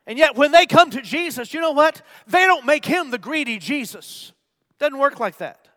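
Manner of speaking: loud, high, emphatic pulpit voice; rousing sermon delivery; full-force preaching. Real, not acted.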